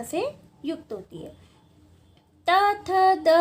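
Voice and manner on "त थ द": त, थ, द said louder, each one distinct; loud consonant demo. Shown as a demonstration. Teacher demonstrating dental sounds to students, louder. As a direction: call out त थ द for the class. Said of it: "बोल के देखोगे तो जीभ बार बार दांतों को touch".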